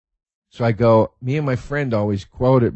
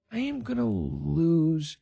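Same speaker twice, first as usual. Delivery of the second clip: stretched-out, word-by-word declaration; slow emphatic vow; 3 semitones higher, 5 dB quieter, much slower than usual. Real, not acted. Fan anticipating the new Star Wars movies, slow and emphatic.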